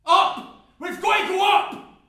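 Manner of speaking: slow, high, full-force shouted order; screaming. Acted. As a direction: scream a command over the blaze. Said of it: "the stranger screams".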